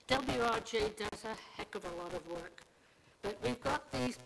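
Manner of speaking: ordinary talk, nothing marked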